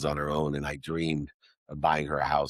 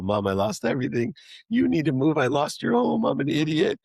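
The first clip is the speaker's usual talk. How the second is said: high, louder tearful confession; tearful blurt; 9 semitones higher, 3 dB louder than usual. Retold or imitated. Confessing to his mom he lost her home, high tearful blurt. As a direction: blurt it out between sobs like a child. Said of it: "finally I blurted out".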